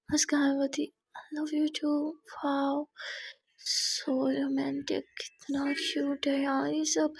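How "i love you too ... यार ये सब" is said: whisper. A narrator's reading. Whispered reply in romantic room.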